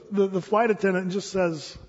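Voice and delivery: ordinary talk, nothing marked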